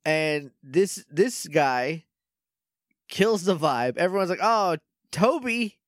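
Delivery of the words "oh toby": mock dismay. Everyone dismayed at the boss.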